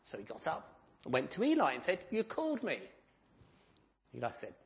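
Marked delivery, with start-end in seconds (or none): 2.1-2.8 eager question. Acted. Samuel runs to Eli.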